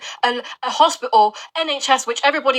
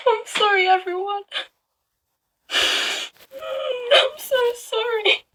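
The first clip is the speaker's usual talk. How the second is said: high, tearful voice breaking; crying apology; 8 semitones higher, slower than usual. Real, not acted. Vlogger breaking down in tears, apologising to her viewers.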